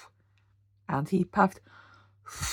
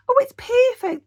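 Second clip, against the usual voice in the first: much higher, louder squeal of delight; gleeful squeal; 9 dB louder than usual. A narrator's reading. Boris gushes to Percy, squealing happily. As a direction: gush with delight. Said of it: "Boris said".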